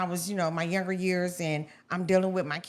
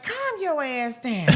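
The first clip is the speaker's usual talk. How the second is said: imitating mom, slow and higher-pitched; mom's voice; 5 semitones higher, slower than usual. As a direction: drag out a mother's retold order. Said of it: "She was like".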